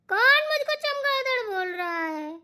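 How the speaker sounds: put-on high, tearful drunken protest; drunken crying whine. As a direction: wail as if insulted while high. Intoxicated woman, offended.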